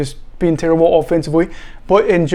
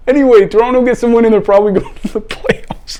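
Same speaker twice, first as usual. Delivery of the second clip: higher and louder, laughter breaking in; laughing; 6 semitones higher, 4 dB louder than usual. Real, not acted. Host recapping Argos' win, laughing through it. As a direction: push on while cracking up. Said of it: "I'm trying not to laugh".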